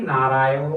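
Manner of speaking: নারায়ণ sung out with a long held final vowel; drawn-out cry of নারায়ণ; prolonged call. Retold or imitated. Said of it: "প্লুতস্বরে ডাকছে".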